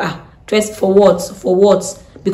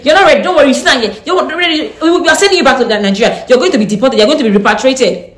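mock officer; 6 semitones higher, 5 dB louder than usual. Mocking the immigration officer's threats.

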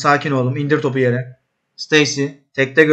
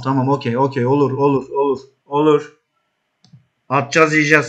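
ordinary talk

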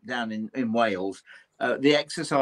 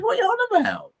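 much higher, baffled question; puzzled Charlie. Retold or imitated. Baffled and high. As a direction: Charlie asks what he means, baffled and high. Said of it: "He said".

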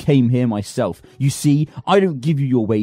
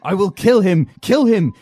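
hysterical threat; 5 semitones higher than usual. Acted. Man raving to Liza about his servant, hysterical threat. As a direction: lose control completely.

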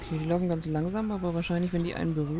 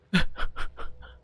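laugh; 5 dB louder than usual. Real, not acted. Laughing at party members.

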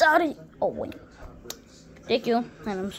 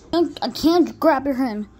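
ordinary delivery

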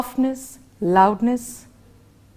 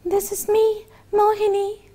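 put-on much higher, small girl's voice; little girl voice; 10 semitones higher than usual. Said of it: "And the little girl answered".

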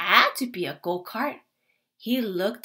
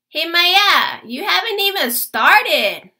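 higher, louder call over the fence; 6 semitones higher, 7 dB louder than usual. A narrator's reading. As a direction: call to the girl next door.

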